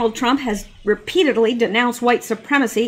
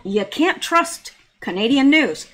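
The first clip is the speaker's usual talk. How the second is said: ordinary delivery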